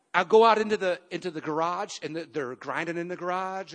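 normal talking voice

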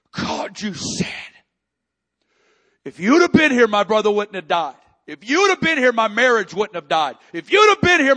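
rising to a loud, anguished shout; desperate crying prayer. Acted. Preacher voicing a broken person crying out to God, anguished shout.

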